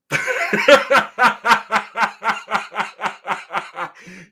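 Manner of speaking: loud, high, rising fit of laughter; howling laugh. Real, not acted. After the loser joke, loud high howling laugh. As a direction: laugh helplessly.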